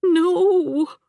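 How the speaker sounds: high, loud cry as her composure cracks; breaking cry. A narrator's reading. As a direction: let the calm shatter on one word. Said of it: "her restrained facade beginning to crack".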